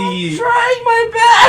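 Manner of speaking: very high, drawn-out wail; squealed protest under pressure; mock-desperate whine. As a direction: plead like a hammed-up crybaby. Host protests being told to think.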